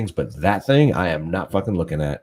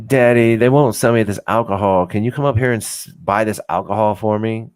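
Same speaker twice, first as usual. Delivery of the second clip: higher-pitched, louder mock plea to Daddy; mocking whiny daddy's girl; 4 semitones higher, 4 dB louder than usual. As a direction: imitate a pissed underage girl begging her father. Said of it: "She was gonna call her daddy".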